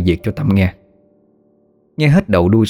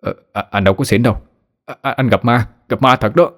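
stammering scared; 2 semitones higher than usual. A narrator's reading. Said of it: "Đô tái mặt mà trả lời".

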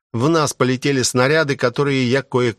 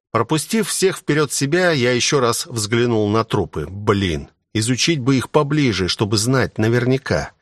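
ordinary delivery, nothing marked

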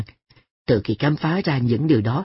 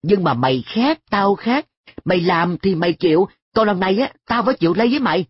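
higher, rushing harsh scolding; 6 semitones higher than usual. A narrator's reading. Boy harshly scolds his friend An, rushing at the end. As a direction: lay the blame on him, speeding up.

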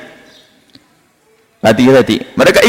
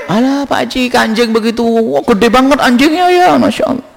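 feigned amazement; 8 semitones higher than usual. Acted. Con man feigns amazement at "dog.

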